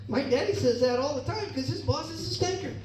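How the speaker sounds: put-on high, soft little-girl voice. Daughter explaining dad's joke to his boss. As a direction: tattle innocently like a small child.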